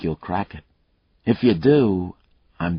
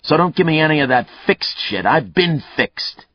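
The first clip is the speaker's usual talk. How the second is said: a hint of a growl, louder and higher; 6 semitones higher, 7 dB louder than usual. Killer growling at the cop about being fixed. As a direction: snarl back at being fixed. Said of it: "Mayle's voice turned to a growl".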